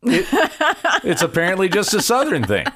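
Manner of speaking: high-pitched, said through real laughter; laughing. Real, not acted. Host tells co-host it's a Southern thing, laughing. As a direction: chuckle your way through the words. Laughs over own family's food habit.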